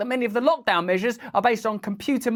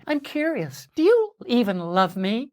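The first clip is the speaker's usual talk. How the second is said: passive-aggressive text; 4 semitones higher than usual. Pretend. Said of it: "passive-aggressive psycho X text".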